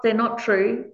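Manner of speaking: ordinary talk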